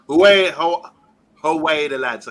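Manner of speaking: loud, poorly imitated Geordie call; attempted Geordie accent. Host hailing a Geordie viewer, attempted Geordie accent. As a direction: have a stab at a Geordie accent. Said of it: "I can never do the Geordie accent".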